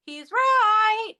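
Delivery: mock divine pronouncement; booming heavenly voice. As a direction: announce it from the sky.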